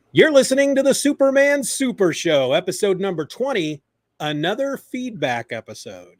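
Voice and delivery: high, big opener that eases down; podcast announcer intro. Acted. Host opening a Superman Super Show feedback episode, announcer intro. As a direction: launch the show with a projected announcer voice.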